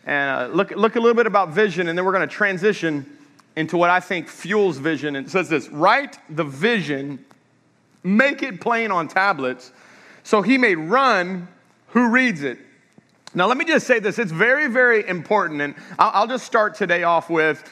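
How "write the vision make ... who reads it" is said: slow scripture reading. Acted. Reading scripture slowly aloud.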